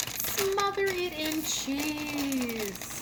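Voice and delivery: drawn out slowly; slow indulgent. Real, not acted. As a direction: savor every word slowly. Drawn out over cheese.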